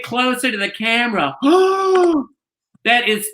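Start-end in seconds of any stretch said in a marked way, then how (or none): 1.4-2.2 high sharp gasp of delight. Real, not acted. Sees her old cat on camera.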